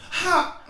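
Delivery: sharp high yelp of sudden pain. Retold or imitated. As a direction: bark a short high cry of pain. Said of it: "first I would yell".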